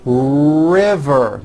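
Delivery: RIVER with a long strong opening R. Shown as a demonstration. Teacher modelling practice word, strong R in RIVER. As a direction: draw out the R, then a buzzed V in RIVER. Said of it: "Notice the strong R".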